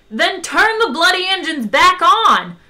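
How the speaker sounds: loud, high-pitched angry bawl. A narrator's reading. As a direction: bawl it out as a furious order. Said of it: "bawled Zaphod".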